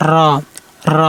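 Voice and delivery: rounded, heavy র; slightly heavy letter. Shown as a demonstration. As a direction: say র thick and full with a short roll. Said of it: "একটু মোটা করবেন".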